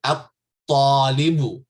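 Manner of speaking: at-talibu, loud and slow, no l; at-talibu, lam silent. Shown as a demonstration. Teacher loudly models at-talibu for students, no lam. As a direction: say at-talibu boldly with a doubled t. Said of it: "I'm not pronouncing the lam".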